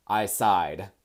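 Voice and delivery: usual speaking voice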